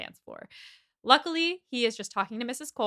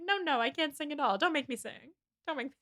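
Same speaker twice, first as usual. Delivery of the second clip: coy mock-protest in raised pitch; lightly put-on reluctant singer, higher voice; Kristen Wiig; 6 semitones higher, 3 dB quieter than usual. [name] protesting he can't sing, coy and higher. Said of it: "Are you doing Kristen Wiig?".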